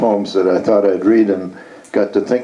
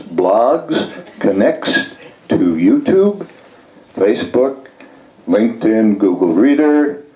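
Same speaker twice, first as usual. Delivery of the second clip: measured poem recital with lifted line endings; performed poem, each item in the list set apart; poetry reading; 4 semitones higher than usual. Acted. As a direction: read it as verse, pausing between phrases and letting list items rise. Reads own poem aloud.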